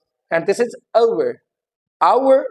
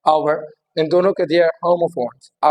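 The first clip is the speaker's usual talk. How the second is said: usual speaking voice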